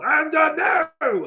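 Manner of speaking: high, loud announcer shout; announcer call. Pretend. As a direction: call it out again like ringside. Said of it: "I'm gonna say that all".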